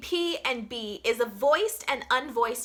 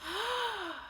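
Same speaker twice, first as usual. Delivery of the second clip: noisy gasp; 9 semitones higher, 9 dB quieter than usual. Shown as a demonstration. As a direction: gasp the air in audibly. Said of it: "So you don't want it to sound like this".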